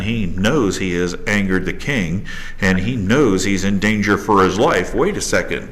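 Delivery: ordinary delivery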